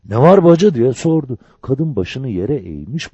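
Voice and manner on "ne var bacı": high, loud, flustered question; startled and anxious, pitched up; alarmed. A narrator's reading. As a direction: ask it in sudden alarm. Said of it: "Telaşla".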